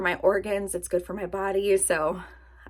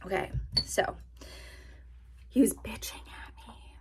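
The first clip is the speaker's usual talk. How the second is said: whisper; 9 dB quieter than usual. Real, not acted. Retelling fight, hushed.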